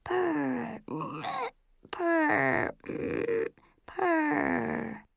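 high, choked cat purr. A narrator's reading. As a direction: imitate a cat's squeezed purr. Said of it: "sounding a little choked".

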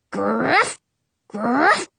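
gruff imitated dog bark; storyteller's bark, rising at the end. A narrator's reading. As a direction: voice a growly little bark. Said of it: "Prince barks".

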